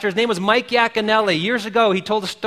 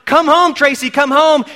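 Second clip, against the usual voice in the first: higher, louder yell; coach yelling; 6 semitones higher, 6 dB louder than usual. Retold or imitated. Coach yelling to Tracy from home plate, loud yell. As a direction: yell her home across the field. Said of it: "her coach is yelling".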